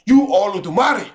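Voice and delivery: put-on light African accent, reverent. Streamer doing his African accent, lightly. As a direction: lightly voice an African elder praising. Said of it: "a African accent".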